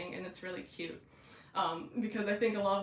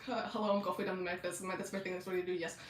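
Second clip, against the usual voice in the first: rushed, nervous patter; fast and nervous; faster than usual. Acted. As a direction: imitate a jittery medic tumbling over his words. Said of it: "he talks really fast and his nerves".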